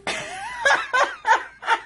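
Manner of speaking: very high squealing laugh; squealing laugh at the silly drawing; real laugh. Real, not acted.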